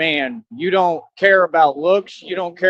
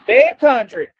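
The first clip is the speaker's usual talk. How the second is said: country accent; 4 semitones higher, 4 dB louder, much slower than usual. Shown as a demonstration. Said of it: "My country accent is coming back out".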